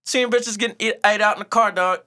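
excited retelling. Real, not acted. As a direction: hype it up with growing glee. Hyped retelling of club night.

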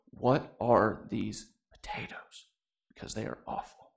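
low, hushed complaint sinking to a whisper; retold under-the-breath question to a wife; hushed aside. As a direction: lean over and whisper a complaint. Leaning over to wife at a meal.

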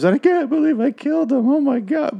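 high, frantic voice full of guilt; guilty killer. Retold or imitated. Agnes shaken after killing them, high frantic guilt. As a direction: imitate her panicking over the killings. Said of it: "where she'll be like".

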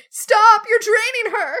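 high, loud, urgent shout; acted-out cry of protest, pitched right up; Victoria's shout. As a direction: shout it out in alarm to stop someone. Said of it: "Victoria shouts".

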